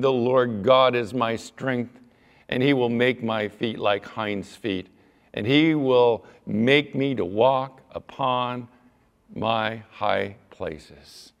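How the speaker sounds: slow, declaiming scripture; preached scripture reading. Acted. Preacher reading verse nineteen to the congregation. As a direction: read the verse with weight.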